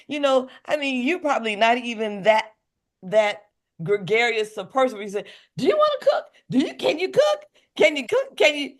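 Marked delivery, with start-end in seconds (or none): 5.6-8.7 high, laughing mock ask; high eager ask about cooking. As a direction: play the pushy recruiter.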